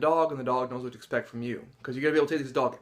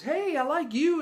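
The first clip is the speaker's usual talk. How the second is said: high, eager dog greeting; friendly dog voice; 14 semitones higher than usual. Retold or imitated. Dog that pursues attention from anyone, high friendly greeting. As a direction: voice an affectionate dog in a raised pitch.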